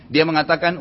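ordinary talk, nothing marked

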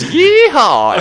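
high, loud whooping cowboy cry; cowboy yeehaw. Retold or imitated. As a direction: whoop it like a rodeo rider. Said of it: "he goes".

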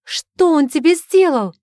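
high, loud horrified outcry; horrified cry. Acted. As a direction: cry out in horror. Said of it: "в ужасе вскрикнула".

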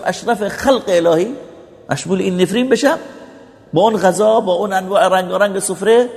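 impassioned preacher's self-reproach, pitched high; indignant questioning. Acted.

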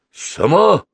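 high, startled question; frightened question. A narrator's reading. Буги asking about the call, frightened. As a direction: blurt it out in alarm. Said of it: "испуганно спросил Буги".

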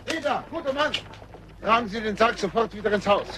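high call across the yard; high commanding call. Acted. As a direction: raise the voice to stop them. Calling after the coffin bearers.

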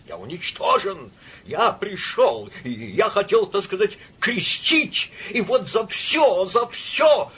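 high, halting, anguished outburst; drawn-out, distraught lament; despairing cry. A narrator's reading. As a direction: build from broken admission to a slow wail. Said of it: "кричал генерал".

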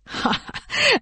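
a short high chuckle; suitor's high laugh. A narrator's reading. Suitor laughing at the mother's threat, high chuckle. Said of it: "Sa Nguyệt Lượng cười".

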